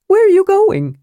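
a startled, lively kender calling out; kender Tass. Acted. Tass voiced, high.

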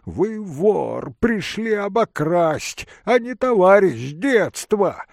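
high, forceful accusation; old man shouting. A narrator's reading. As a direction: accuse indignantly, raising the pitch. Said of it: "кричал старик". (старик гневно обвиняет, высоко)

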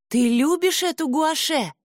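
high startled cry; shouting. A narrator's reading. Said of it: "закричала Айсет".

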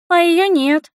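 put-on high child's voice; voiced young girl. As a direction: answer as the kid on the phone. (высокий детский голосок)

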